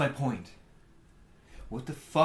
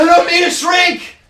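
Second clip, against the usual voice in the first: much higher, louder desperate cry; loud protest; 10 semitones higher, 10 dB louder than usual. Acted. Student protests being sent away.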